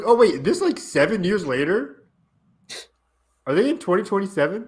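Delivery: high-pitched retold bafflement; shocked reaction. Viewer retells seeing the time jump, shocked. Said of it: "I was shocked".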